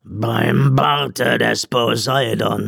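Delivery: slow, drawn-out exasperated oath; weary captain at his wits' end; despairing murmur. A narrator's reading. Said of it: "murmelte der Kapitän verzweifelt".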